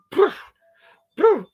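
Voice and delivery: dismissive poof. Retold or imitated. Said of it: "she was like".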